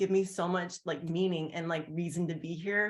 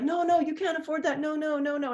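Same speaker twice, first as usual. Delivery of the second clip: much higher voice, quick polite refusals; sister refusing; 8 semitones higher than usual. Retold or imitated. Toothless sister turning down an offer of new teeth, high protest. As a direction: play the shy sister waving off a costly gift. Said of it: "And she was like".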